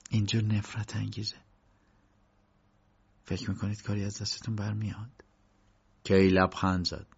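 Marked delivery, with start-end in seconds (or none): 0.1-5.2 quietly. Acted. Woman confiding to K in the empty hall, hushed. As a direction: keep it hushed so no one overhears. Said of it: "زن آرام به کی گفت".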